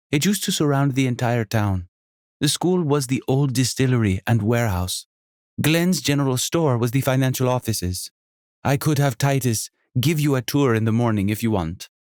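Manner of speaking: high, lively hostess voice with a faint Jamaican lilt; a welcoming, bubbly landlady; energetic innkeeper. Acted. Sonia continues.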